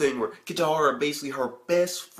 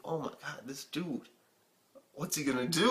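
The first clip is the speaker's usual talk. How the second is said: much softer, like thinking aloud; hushed inner reaction; 6 dB quieter than usual. Retold or imitated. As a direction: drop to a murmur of disbelief. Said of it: "I'm like".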